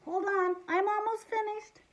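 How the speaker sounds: put-on high-pitched kid's plea; engrossed boy protesting. Boy reading late answers his parents, high protest.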